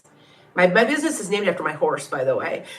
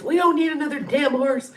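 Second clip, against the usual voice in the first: a higher mock gruff husband grumble; mimicking the annoyed husband, pitched up; 8 semitones higher than usual. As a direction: imitate him grumbling it.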